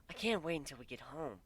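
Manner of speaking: eager to get home; bright eager Kuno voice; happy, bouncy. A narrator's reading. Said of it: "with a happy smile and a little bounce".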